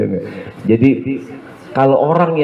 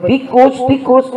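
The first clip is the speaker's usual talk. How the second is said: 1 mock panic; slower than usual. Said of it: "husband says rats".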